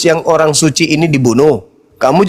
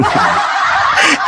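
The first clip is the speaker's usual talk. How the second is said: high breathy laugh; 11 semitones higher than usual. Real, not acted. Laughing at the caller.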